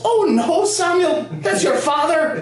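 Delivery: put-on very high old-lady voice, slow and laughing at the end; innocently pin it on someone else; old Irish granny. Grandson imitates grandma denying the fart, very high granny voice. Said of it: "She goes".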